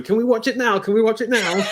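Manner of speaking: high, eager imitation of a child; pestering little kid. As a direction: play an excited kid begging and nagging. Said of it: "like you were a little kid going".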